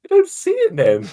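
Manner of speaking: very high squeaked reply; squeaky denial. Real, not acted. Sticking to the denial with partner, squeaky. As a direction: answer back in a tiny high voice.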